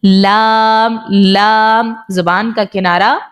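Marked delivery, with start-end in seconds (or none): 0.0-1.9 لام held long and level; لام with a long sustained vowel; articulated lam. Shown as a demonstration. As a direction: draw out لام, tongue edge against the gums. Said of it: "اس کے مسوڑوں میں جا کے لگ رہا ہے".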